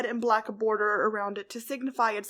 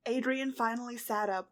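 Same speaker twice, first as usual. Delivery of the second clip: ordinary delivery, nothing marked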